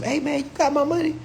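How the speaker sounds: high mock pleading voice; asking for money. Lender politely asking the borrower for his money, high mock voice. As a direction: play the lender asking nicely.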